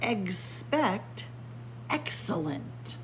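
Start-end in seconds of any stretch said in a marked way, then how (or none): none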